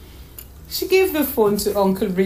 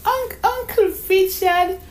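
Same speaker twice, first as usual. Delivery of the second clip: put-on high, stammering child's wonder; stretched, high-voiced disbelief; amazed little girl; 12 semitones higher than usual. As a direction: marvel at uncle like a small girl. Child amazed, addresses her uncle.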